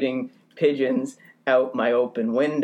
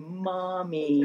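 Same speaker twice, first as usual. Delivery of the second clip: put-on adoring baby; 5 semitones higher, 5 dB quieter than usual. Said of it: "looking up at it adoringly as if to say".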